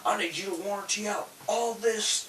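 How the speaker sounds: high-pitched mock Irish customer; rough attempt at an Irish accent. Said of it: "he's had an Irish accent, not a, you know, like, gangster accent".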